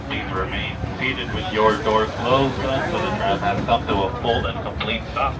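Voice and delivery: ordinary talk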